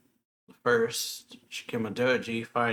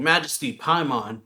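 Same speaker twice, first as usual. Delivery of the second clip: raised, louder call to a ruler; mild retold yell; 4 semitones higher, 7 dB louder than usual. Messenger running in to His Majesty, mildly yelling. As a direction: call out urgently, short of a real shout.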